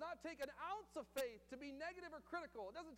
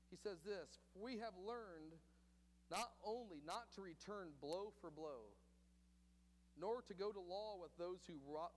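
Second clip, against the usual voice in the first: 1 solemn reading; 7 semitones lower than usual. Real, not acted. Reading old church quotes.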